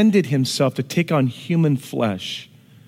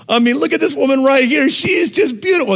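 loud, high, excited praise; excited praise of his wife; 10 semitones higher, 4 dB louder than usual. Real, not acted. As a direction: show her off to the room.

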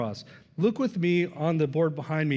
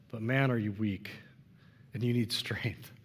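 whisper; 6 semitones lower, 6 dB quieter than usual. Acted. Pastoral aside to Peter.

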